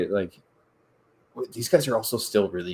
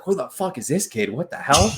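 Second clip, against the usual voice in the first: lightly voiced amazement, slightly higher; milder retold disbelief; 7 semitones higher than usual. Retelling the veteran's reaction between whistles, mild disbelief. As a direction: retell the shock with less force. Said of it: "just like".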